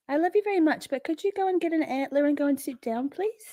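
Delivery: high, soft pet-talk voice; sweet dog talk. Real, not acted. Owner asking her dog Poppy to sit, high coaxing pet voice.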